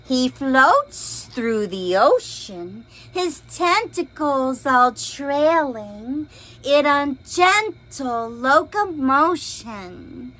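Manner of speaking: lively storybook read-aloud; expressive picture book reading; reading to children. A narrator's reading. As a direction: read it big for little listeners.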